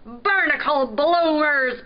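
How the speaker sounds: loud, put-on pirate grumbling; pirate grumble. A narrator's reading. Pirate seeing a newcomer arrive, grumbling. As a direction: curse crossly like a salty pirate. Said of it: "Pirate grumbled".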